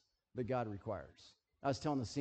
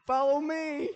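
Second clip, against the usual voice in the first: mock airline staff; 10 semitones higher, 6 dB louder than usual. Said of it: "people are like".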